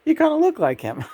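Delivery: high, quick giggly repeat; tickled, laughing it back. Real, not acted. Laughing at psychic's remark.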